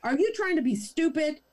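mock Karen scorn; exasperated sneer. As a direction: sneer at the idiot. Karen insulting narrator.

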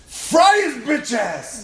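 slow, high, loud taunt; fierce yell. Real, not acted. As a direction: drag out the kill cry. Cheering killing the bug.

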